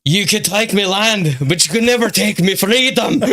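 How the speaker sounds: a second stab at the Braveheart speech in Scottish; asked to try the Scottish accent again, laughing; Scottish accent retry. Retold or imitated. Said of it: "I could try to do it better".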